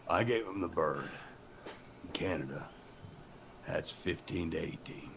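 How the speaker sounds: solemn, low confession; Canadian prisoner. Acted. Canadian prisoner confesses to cellmate, low and grave. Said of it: "they cut to the prison".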